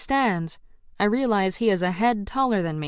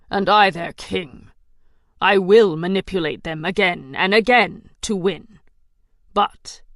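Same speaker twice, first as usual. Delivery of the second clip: dark ruler, slow and scornful; menacing villain king; 2 semitones lower than usual. Acted. Villain king's speech.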